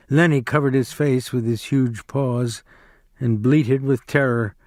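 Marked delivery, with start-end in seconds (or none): none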